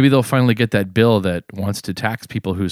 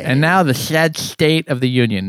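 mock pompous show opener; grandiose affected voice; 6 semitones higher than usual. Shown as a demonstration. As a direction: puff it up like a pompous broadcaster. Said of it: "No, I'm just gonna read it regular".

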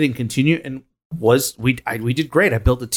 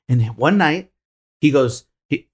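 normal talking voice

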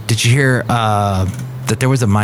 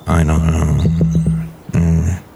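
much lower, slow put-on voice; deep character voice; 7 semitones lower, slower than usual. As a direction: sink into a low dopey character. John Deere answers.

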